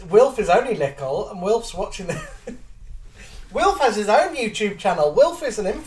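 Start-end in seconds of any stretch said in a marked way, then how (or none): 2.5-5.9 giggling through the words; laughing. Real, not acted. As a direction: laugh while you say it. Fond joke about little Wilf.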